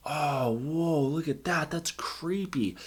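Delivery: soft, slow marveling at a creepy set; quietly amazed viewer; hushed mock awe. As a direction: breathe it out like a wowed viewer. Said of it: "really take it in and go".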